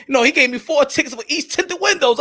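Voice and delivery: normal talking voice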